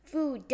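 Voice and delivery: "Food" in two beats, "foo-duh"; own odd pronunciation. Shown as a demonstration. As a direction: pop the D of "Food" as its own syllable. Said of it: "that's how I pronounce it".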